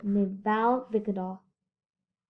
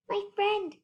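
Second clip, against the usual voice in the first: small voice pitched much higher; a speck-sized mayor calling up; little Who voice, high and light; tiny mayor voice; 11 semitones higher than usual. A narrator's reading.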